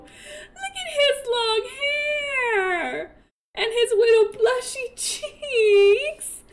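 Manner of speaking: high, very drawn-out gushing. Real, not acted. Cooing over second aged-up baby.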